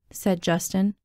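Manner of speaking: usual speaking voice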